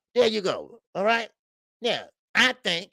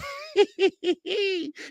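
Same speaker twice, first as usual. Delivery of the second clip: giddy laugh; 13 semitones higher than usual. Retold or imitated. Voiced fighter laughing giddily.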